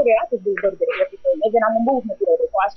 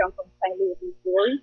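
usual speaking voice

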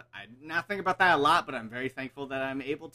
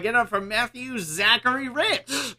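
high, loud emcee build-up; hype announcer intro; 10 semitones higher, 8 dB louder than usual. Real, not acted. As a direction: bring the guest out to a roaring crowd. Host announces his guest like emcee.

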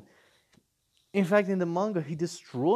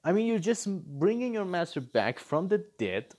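usual speaking voice

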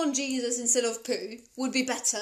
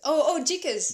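higher, louder mild exclamation; exclaiming; 4 semitones higher, 6 dB louder than usual. Shown as a demonstration. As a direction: blurt the mild curse. Demo of mild curse.

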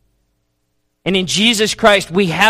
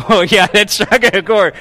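ordinary delivery

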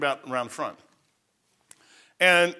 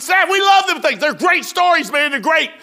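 mock cheeky shout; 12 semitones higher, 7 dB louder than usual. Retold answer to sergeant.

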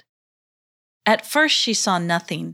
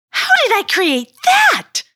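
high, loud horrified scream; 8 dB louder than usual. Acted. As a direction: scream it in horror. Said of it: "screamed Heichu".

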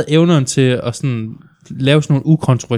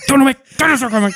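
higher-pitched mock yell at a dog; mock exasperated shooing; 11 semitones higher, faster than usual. Man shoos a dog that disrupts his push-ups, mock exasperated. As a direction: pretend to chase off a pestering dog.

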